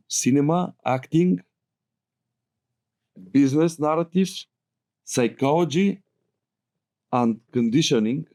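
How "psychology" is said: ordinary talk, nothing marked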